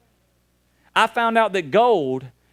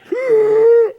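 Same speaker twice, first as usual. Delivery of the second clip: loud, drawn-in mock gasp; audible gasp; 7 dB louder than usual. Imitating the people around the pool, loud shocked gasp. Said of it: "an audible gasp".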